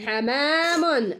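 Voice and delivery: stretched very slowly, each sound drawn out; slow model word. Shown as a demonstration. Teacher models the word for Nizar, drawn-out slow. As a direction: spell it out slowly so the boy hears the middle letter. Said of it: "الآن الحرف في وسط الكلمة".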